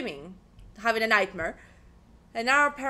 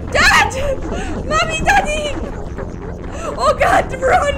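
loud, high frantic yelling; shrieked game-chase alarm; panicked shouting; 11 semitones higher, 10 dB louder than usual. Real, not acted.

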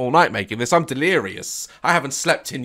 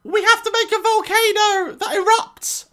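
put-on much higher excited child voice; little daughter; 3 dB louder than usual. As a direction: play the small girl asking for help. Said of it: "she's like".

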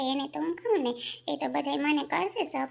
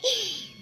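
high laugh; 11 semitones higher than usual. Real, not acted. Laughs at own joke.

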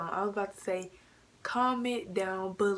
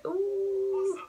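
high surprised ooh; 12 semitones higher than usual. Real, not acted. Reacting to the clip.

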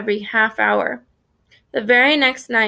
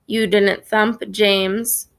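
ordinary delivery

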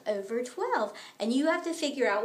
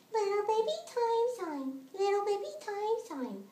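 put-on higher, cooing baby voice; little baby voice; 5 semitones higher, 4 dB quieter than usual. Shown as a demonstration. Math teacher singing out in a baby voice. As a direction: coo the words like a tiny baby. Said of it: "you say it in a little baby voice".

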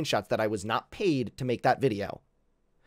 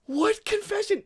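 outraged shout. Real, not acted. Streamer shouting at the accuser about the confession. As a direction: shout it in disbelief.

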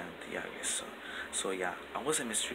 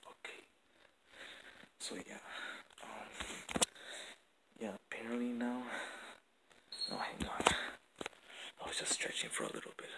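whispering. Real, not acted. Whispering at night in the house.

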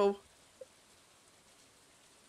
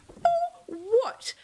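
outraged; 12 semitones higher than usual. Real, not acted. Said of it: "charged me a hundred".

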